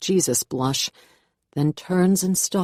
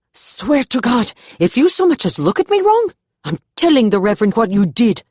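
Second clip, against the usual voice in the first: high, loud furious warning; angry threat; 7 semitones higher, 7 dB louder than usual. A narrator's reading. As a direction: threaten her, voice shooting up high. Martina threatens Ayana after Reverend leaves.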